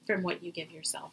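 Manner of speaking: normal talking voice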